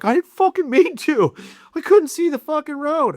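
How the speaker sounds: high, loud, squealing protest; panicked high shout of a guilty driver; frantic defensive yell. Retold or imitated. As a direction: yelp it like the driver who crashed. Driver defends himself, mocked.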